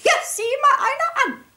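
high, bright squeak of surprise; high squeaky exclamation; squeaky high teacher. A narrator's reading. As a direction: squeal it out like a fluttery lady. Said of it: "gickste Frau Schneckmeier".

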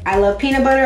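ordinary delivery

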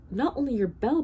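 cried out. A narrator's reading. Bartholomew warning the bell ringer, crying out. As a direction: cry out in alarm. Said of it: "Bartholomew cried".

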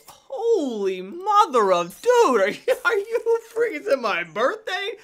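loud, high, laughing amazement; thrilled disbelief. Real, not acted. Streamer stumbles on a lucky find, loudly thrilled. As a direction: shout in delighted astonishment.

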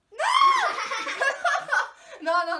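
loud high laughing shriek; nervous laugh. Real, not acted. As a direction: laugh out in disgust. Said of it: "Mi viene da ridere dal nervoso!".

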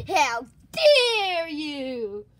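loud, slow, drawn-out outrage; angry. Acted. Gorilla to those who laughed at him, angry shout. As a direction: roar it slowly in acted indignation. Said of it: "and got angry".

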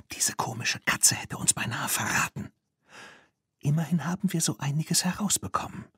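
hushed whisper. Acted. Girls hiding in the shed, whispering. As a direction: keep it under your breath, hiding.

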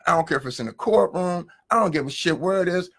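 ordinary talk, nothing marked

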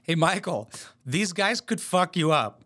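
normal talking voice

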